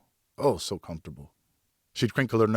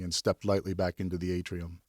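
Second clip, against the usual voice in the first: ordinary delivery, nothing marked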